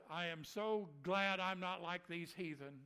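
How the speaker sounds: loud, slow, pompous proclamation; smug self-righteous boast. Retold or imitated. Chief priest boasting in the temple, loud and pompous. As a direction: puff up like a proud priest.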